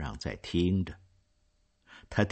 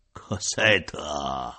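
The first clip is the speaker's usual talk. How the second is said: trembling call of her name; 5 semitones lower, much slower than usual. Acted. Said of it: "冉阿让喊道".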